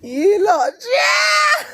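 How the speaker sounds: very high, loud shriek; piercingly high hollered exclamation; shrieked catchphrase. Real, not acted. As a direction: squeal it out at full voice. Shrieks out her catchphrase in hilarity.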